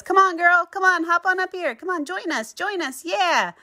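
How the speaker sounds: high, coaxing voice to a pet; coaxing call. Acted. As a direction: sweet-talk them up onto the ducky. Calling to the ducky and others.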